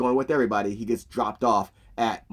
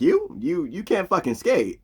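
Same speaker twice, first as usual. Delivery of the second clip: incredulous high-pitched "you?", then scoffing; mock disbelief; 7 semitones higher than usual. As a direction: scoff at a kid's big claim. Shoresy mocks a kid's boast.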